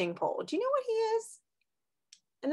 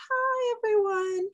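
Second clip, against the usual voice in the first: puppet turtle voice; 11 semitones higher than usual. Pretend. Turtle puppet greets class.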